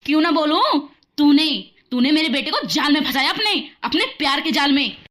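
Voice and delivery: loud, hard shouting mother-in-law; mother-in-law shouting at the bride; furious accusation. Acted. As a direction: hurl the accusation at her.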